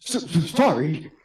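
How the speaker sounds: high, slow, stuttered apology; flustered stammer. Acted. Newcomer apologises to club girl, flustered stammer.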